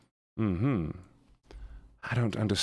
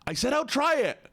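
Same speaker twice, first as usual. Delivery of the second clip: exasperated outburst. Real, not acted. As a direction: throw up your hands and insist you'll try it. Exasperated insistence on trying it.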